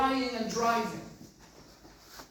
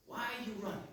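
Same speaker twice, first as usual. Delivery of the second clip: softer, hushed voicing of the lady's question; 11 dB quieter, faster than usual. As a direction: ask it softly, almost to yourself.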